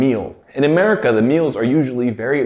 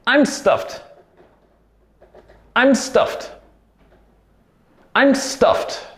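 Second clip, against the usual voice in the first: acting stuffed; 9 semitones higher, much slower than usual. Acting full after a meal.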